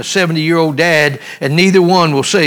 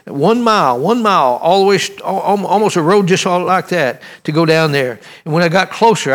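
ordinary delivery

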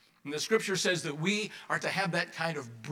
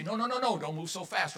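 higher-pitched, fussy string of orders; urgent nagging; 5 semitones higher, 4 dB louder than usual. Acted. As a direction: cut in quickly to stop someone moving. Voicing people ordering a patient around.

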